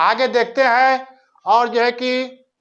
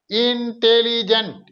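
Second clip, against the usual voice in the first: Intelligent slow and higher, each syllable drawn out; 4 semitones higher, slower than usual. Shown as a demonstration. Teacher showing a three-syllable word, intelligent slow, high and measured. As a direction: spell out the sounds of intelligent slowly, raised in pitch. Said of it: "ये तीन syllable का हो गया".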